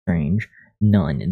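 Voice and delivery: ordinary talk, nothing marked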